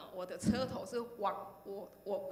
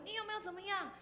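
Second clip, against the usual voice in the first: put-on high, fretful young woman's voice; worried girl rushing up to ask; anxious young woman; 10 semitones higher than usual. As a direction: imitate her panicked asking. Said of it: "跑过来问我".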